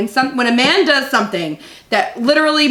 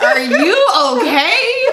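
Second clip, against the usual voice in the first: laughing mock concern; 5 semitones higher, much slower than usual. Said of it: "And I'm like".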